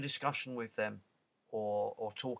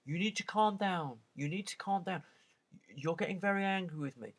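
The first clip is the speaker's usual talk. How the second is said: a higher, lightly put-on patronising agent; mock call-centre agent; 6 semitones higher than usual. Phone agent talking down to a customer, lightly mocked. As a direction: talk down to the caller like a smug phone agent. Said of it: "you're saying".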